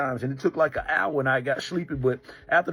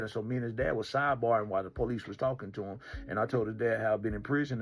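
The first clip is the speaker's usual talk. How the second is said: ordinary talk, nothing marked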